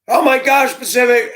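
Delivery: put-on a gushing teenage girl greeting him; imitating a teen girl. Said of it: "a teenage girl sees you".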